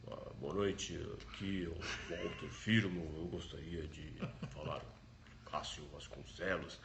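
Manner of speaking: deep, slow, formal captain voice; airline captain voice. Retold or imitated. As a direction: imitate a smooth pilot voice. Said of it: "voz de comandante de avião".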